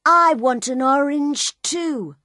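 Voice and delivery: put-on loud, high little girl demanding. A narrator's reading. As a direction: call it out like a greedy kid. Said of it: "the little girl said loudly".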